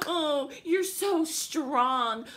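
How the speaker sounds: slow, drawn-out sarcastic gushing; heavily sarcastic fake admiration; mock sympathy. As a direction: gush mockingly like a fawning commenter. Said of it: "the fucking comments on some".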